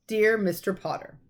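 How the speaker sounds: plain reading voice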